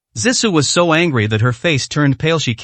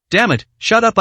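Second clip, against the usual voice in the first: shouting; 7 semitones higher than usual. A narrator's reading. Thug yells at Yi Bei.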